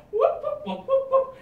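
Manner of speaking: high squeaky stooge whoops; Curly whoop. Retold or imitated. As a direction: yelp like a slapstick comic. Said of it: "going in circles like Curly".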